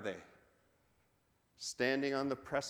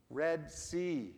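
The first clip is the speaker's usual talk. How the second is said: ordinary delivery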